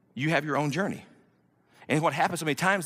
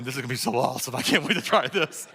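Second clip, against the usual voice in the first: eager anticipation; 5 semitones lower than usual. Acted. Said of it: "you can see my eyes going".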